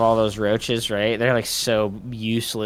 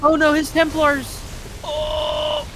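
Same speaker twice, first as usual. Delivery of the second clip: shocked dismay; 14 semitones higher, slower than usual. Real, not acted. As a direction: gasp it out. Templars caught.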